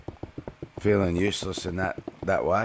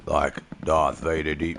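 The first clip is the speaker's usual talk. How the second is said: Darth Vader deep; 4 semitones lower than usual. Pretend. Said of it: "Is it gonna get deeper?".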